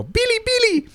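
very high little gremlin chirp; squeaky gremlin noise. Retold or imitated. Player imitating his gremlin staff when hit, squeaky. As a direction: squeal it like a tiny gremlin toy. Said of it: "every time I would hit it and go".

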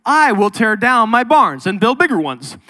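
mildly hammed-up smug rich man; boastful rich man. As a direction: brag about the bigger barns. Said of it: "Then he said".

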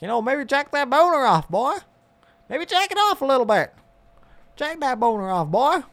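high mock sermon to teenage boys, hammed up; mock preacher voice. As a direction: preach it high like a goofy pastor.

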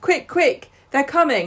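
high, urgent hurried warning; panicky urgency. Retold or imitated. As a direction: hiss a rushed warning, pitched high. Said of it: "Lucy's there going".

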